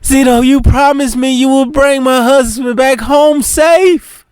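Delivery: high, sobbing plea; crying hard. Acted. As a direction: wail his name through sobs. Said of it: "She starts crying really hard now".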